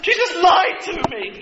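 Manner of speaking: sibling telling on Jesus to Mom, whining; tattling child. Pretend. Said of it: "can you imagine, like".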